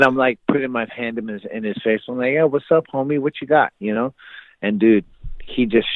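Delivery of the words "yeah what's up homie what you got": higher-pitched mock challenge; cocky taunt. Teen to friend before a takedown, taunting. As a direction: re-enact goading a smaller opponent. Acts out taunting his opponent.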